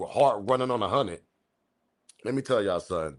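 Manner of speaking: ordinary delivery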